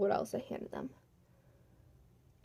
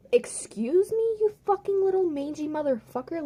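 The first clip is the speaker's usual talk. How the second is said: louder, higher, outraged cursing; indignant outburst; 7 semitones higher, 4 dB louder than usual. Acted. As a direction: curse the absent boss out in outrage. Indignant outburst at boss's accusation.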